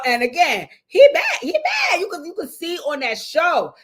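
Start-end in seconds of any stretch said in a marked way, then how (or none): 0.9-2.0 shouts it high and loud; loud outburst. Real, not acted. Loud outburst while watching the show. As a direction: belt it out in disbelief.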